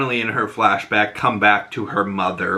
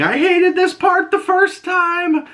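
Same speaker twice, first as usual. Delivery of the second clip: very high, drawn-out screamed line; mock scream held all through, stretched out; imitated screaming; slower than usual. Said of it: "when she's screaming".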